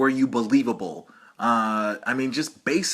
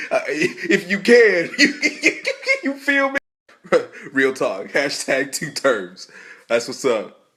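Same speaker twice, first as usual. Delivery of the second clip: laughing pretend chat; 4 semitones higher, 6 dB louder, slower than usual.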